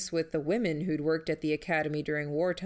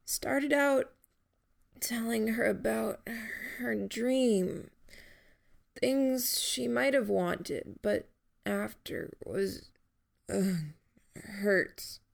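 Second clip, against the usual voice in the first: higher, slow, breaking into a cry at the end; pained rambling; 4 semitones higher, slower than usual. Acted. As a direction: drift through the words, pain breaking through. Dying Jamie rambles in pain.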